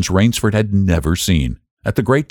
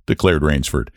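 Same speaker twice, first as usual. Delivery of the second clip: ordinary delivery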